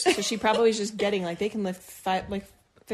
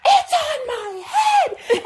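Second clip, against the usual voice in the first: very high, slow, loud shout; desperate cry with the spider on your head; panicked shout; 7 dB louder, much slower than usual. Retold or imitated. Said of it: "And I'm like".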